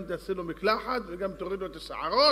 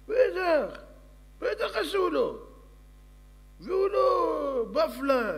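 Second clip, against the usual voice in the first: much higher, slow squeaky servant voice; eager servant; 12 semitones higher, 5 dB louder, much slower than usual. Acted. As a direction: draw out the words like a fawning servant. (קול משרת גבוה ואיטי)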